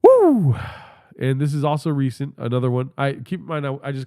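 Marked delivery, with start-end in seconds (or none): 0.0-0.6 high loud whooping cheer; loud high woo of glee; excited whoop. Real, not acted. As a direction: let out a big woo. Excited outburst.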